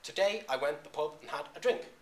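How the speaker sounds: "today I went to the pub and had a drink" with every other syllable hit; iambic stress. Shown as a demonstration. Lecturer showing students pentameter, "today I went to the pub" in iambic beats. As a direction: beat out "toDAY i WENT" in alternating weak and strong syllables. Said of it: "every other syllable is stressed".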